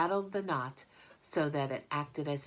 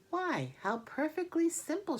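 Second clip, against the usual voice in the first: high, delighted little discovery; light, pleased spider voice; Charlotte's voice; 13 semitones higher than usual. Acted. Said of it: "she said to herself".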